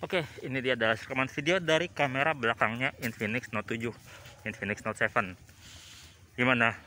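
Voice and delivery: muffled behind a thick mask; through a face mask. Real, not acted. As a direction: talk through a two-layer mask. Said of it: "ini saya pakai masker, jadi suaranya agak ketutup ya".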